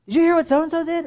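high, loud mock gossip; gossipy excitement. Everyone spreading news on Facebook, excited and high.

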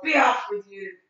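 loud gruff shoo; troll waving off the little goat; troll dismissal. Acted. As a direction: send him off like a troll.

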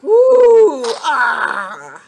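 usual speaking voice

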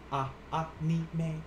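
"anime" slowly, syllable by syllable from "Ah"; slow Japanese pronunciation. Shown as a demonstration. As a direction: model "Ah, anime" slowly with an open "ah". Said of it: "A will always make the ah sound".

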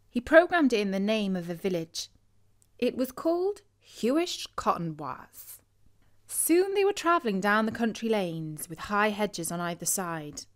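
ordinary delivery, nothing marked

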